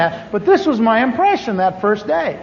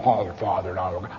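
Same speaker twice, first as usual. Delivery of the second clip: much lower, softer, solemn intoning; mock churchy voice; 14 semitones lower, 10 dB quieter than usual. The narrator mocks the church people's talk in a much lower solemn mock intone. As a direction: parody a pious preacher's line. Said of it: "I can't relate to".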